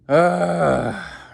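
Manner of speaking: big open sigh up high; loud sigh. Shown as a demonstration. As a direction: let out a loud tired sigh. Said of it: "we're just like".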